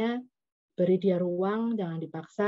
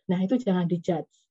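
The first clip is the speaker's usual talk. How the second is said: ordinary talk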